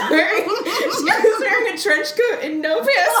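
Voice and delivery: high, slow words broken by laughter; laughing hard. Real, not acted. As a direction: struggle to speak through giggles. Cracking up at the absurd detail.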